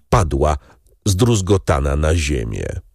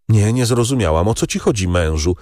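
ordinary delivery, nothing marked

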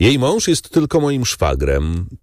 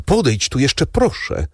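pleading call; 7 semitones higher than usual. A narrator's reading. Woman begs her beloved to come back to her, pleading call. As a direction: implore him in a raised pitch. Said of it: "zawołała Valentin".

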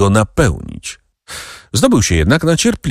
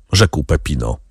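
ordinary delivery, nothing marked

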